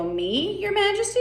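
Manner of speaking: questioning, leaping high at the end; startled Alice. Acted. Alice answers the Queen, voice leaping high in question. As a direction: ask it in high-pitched disbelief. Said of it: "Alice is gonna go".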